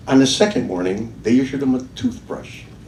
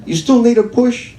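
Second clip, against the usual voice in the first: louder, higher call into the rain; 11 semitones higher, 5 dB louder than usual. Acted. A man at his door calling out to the drunk in the rain, loud. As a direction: shout out to find him.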